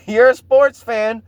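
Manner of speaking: ordinary talk, nothing marked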